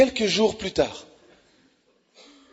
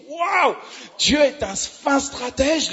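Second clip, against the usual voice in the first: awed wow; 6 semitones higher, much slower than usual. Real, not acted. Exclaiming wonder at God.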